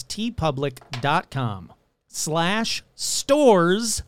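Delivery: very slow, spelled-out web address. Shown as a demonstration. Host slowing the merch address for listeners, deliberately slow. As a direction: say "T public dot com slash stores" slowly, piece by piece. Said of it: "Let me slow that down".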